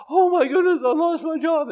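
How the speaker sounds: high, loud fake alarm; mock panic. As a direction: act out panicking over a lost job. Imagined panicking person.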